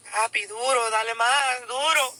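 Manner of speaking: a hint of a screaming lover, half-hearted; mock scream. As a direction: ham up her cries lightly. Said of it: "She's screaming".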